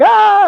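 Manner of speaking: put-on sharp high scream of a trapped boy; mock panicked yelp. Boy with his hand stuck in the box, panicked yelp. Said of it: "he's like".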